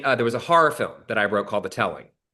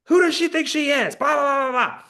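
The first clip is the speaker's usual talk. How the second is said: very high, louder mock tirade; mock screaming rant; 3 dB louder than usual. Writer ranting in hair and makeup, high mock fury. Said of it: "I'm just screaming".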